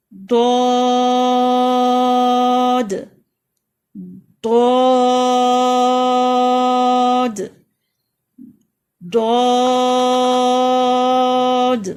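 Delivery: دود slow, loud, mouth open upward; heavy letter drawn out. Shown as a demonstration. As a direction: stretch دود heavy and full. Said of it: "یہ heavy letter ہے".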